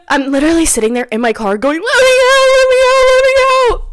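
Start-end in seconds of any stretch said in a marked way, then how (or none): 1.8-3.8 very high, loud panicked wail; scream-cry it as if trapped, hammed up; acted sobbing. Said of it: "I'm, like, sobbing".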